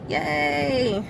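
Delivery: usual speaking voice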